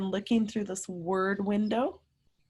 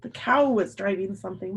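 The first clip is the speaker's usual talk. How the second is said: plain reading voice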